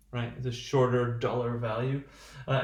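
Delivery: normal talking voice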